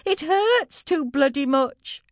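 high, loud, slow cry of pain; labouring girl wailing high and slow. Acted. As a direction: drag it out through the pain.

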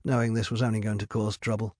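ordinary delivery, nothing marked

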